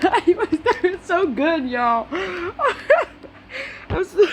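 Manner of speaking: high giggling through words; laughing. Real, not acted. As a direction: break into laughter. Laughing mid-reading.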